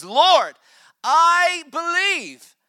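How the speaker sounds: desperate, high, loud cry to Jesus. Acted. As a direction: cry it out slowly at full voice, stretching the words. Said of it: "he's crying out to Jesus".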